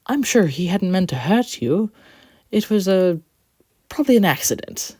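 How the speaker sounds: a cheerful little engine; Quincy voice. A narrator's reading.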